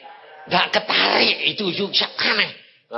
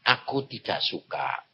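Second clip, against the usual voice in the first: voice of God; 11 semitones lower, 5 dB quieter than usual. Retold or imitated. God's voice retold in sermon.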